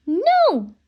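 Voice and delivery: loud, high cry of alarm; a sharp frightened no. Acted. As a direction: Annie shouts to stop the leopard. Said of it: "cried Annie".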